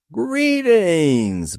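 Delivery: a big, booming, cheerful hello; booming cheery greeting. A narrator's reading. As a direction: boom it out like a jolly fat man. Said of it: "boomed the cheery vision".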